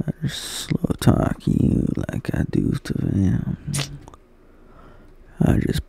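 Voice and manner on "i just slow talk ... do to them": slow, hushed country drawl; slow-talk it in a soft country accent. Shown as a demonstration. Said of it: "I just had to put in a country accent".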